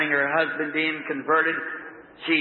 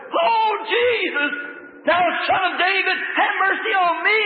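screaming plea; 12 semitones higher, 4 dB louder than usual. Acted. Old beggar crying to Jesus over the crowd, screaming. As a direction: cry out for mercy at full voice. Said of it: "scream to the top of his voice".

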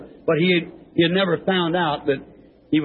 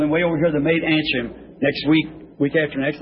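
ordinary talk, nothing marked

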